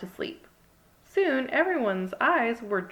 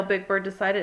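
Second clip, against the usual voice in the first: ordinary delivery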